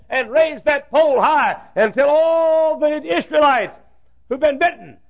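high, loud, ringing preacher's cry; full-force preaching. Acted. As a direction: throw the Bible dialogue out to the whole hall at the top of the voice. Preaching at full force, voices God.

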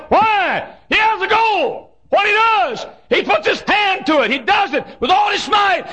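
high, shouted pulpit climax; the pastor shouting the coach's example to the congregation; full-force preaching. Acted.

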